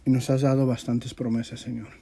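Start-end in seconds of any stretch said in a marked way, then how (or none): none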